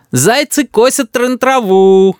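put-on high, slow, drawn-out child's chant; sing-song taunt. A narrator's reading. Boy hides behind grandmother, taunting father. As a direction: stretch it out teasingly, up high.

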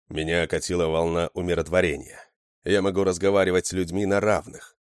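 ordinary delivery

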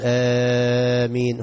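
آمين بمد عادي; قل "آمين" بمد معتدل; 1 normal amen. Shown as a demonstration. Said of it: "الناس تبقى بتقول". (آمين بمد طبيعي)

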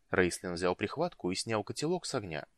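ordinary delivery, nothing marked

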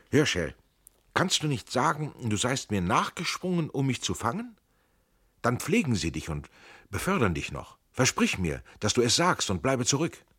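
usual speaking voice

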